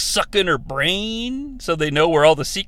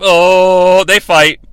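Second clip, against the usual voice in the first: ordinary talk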